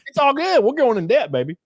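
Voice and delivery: high, loud trailer park guy act; mock carefree reassurance. Trailer park guy reassuring his woman, hammed-up carefree.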